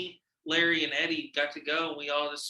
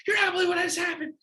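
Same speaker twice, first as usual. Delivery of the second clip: high, loud and fast, bursting with news; shouting the news to his ex as he gets home; excited shout; 6 dB louder, much faster than usual. Retold or imitated. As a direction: blurt it out, overjoyed. Bursting in to tell ex.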